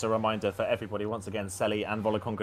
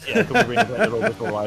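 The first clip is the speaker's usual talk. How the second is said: louder, laughing through the joke; 13 semitones higher, 11 dB louder than usual. Real, not acted. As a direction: laugh while asking. Joking with co-commentator, laughing.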